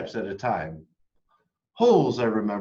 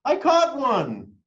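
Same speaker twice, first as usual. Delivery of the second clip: much higher, loud, slow triumphant shout; triumphant call; 6 dB louder, slower than usual. A narrator's reading. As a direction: call out above everyone, thrilled. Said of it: "a voice called out above the others".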